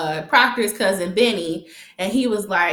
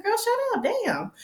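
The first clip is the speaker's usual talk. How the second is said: high, softer eye-roll brush-off; high dismissive retort; 13 semitones higher, 6 dB quieter than usual. Retold or imitated. Viewer telling Keisha to shut up, high and dismissive. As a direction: wave her off, pitched up.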